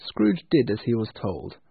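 usual speaking voice

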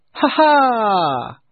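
big, loud, high laugh; nephew's booming laugh; hearty laugh. Acted. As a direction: laugh out with delight. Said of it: "laughed a voice he knew well".

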